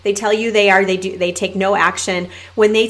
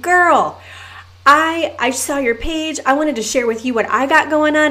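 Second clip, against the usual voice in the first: imitating pumped-up caller; 6 semitones higher than usual. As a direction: play the overexcited cold-messager. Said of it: "she was so excited".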